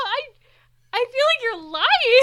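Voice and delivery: high, loud, incredulous protest; real disbelief. Real, not acted. Co-host to host after casting reveal, loud disbelief. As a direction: protest loudly that it can't be true.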